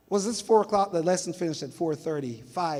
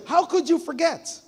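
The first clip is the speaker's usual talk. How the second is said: high, louder, slow indignant question; wife confronting her husband, high indignant demand; 11 semitones higher, 7 dB louder, slower than usual. Retold or imitated. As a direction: demand an answer in disbelief. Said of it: "she said to him".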